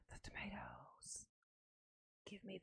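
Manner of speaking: breathy unvoiced whisper of "tomatoes". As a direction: whisper it mysteriously.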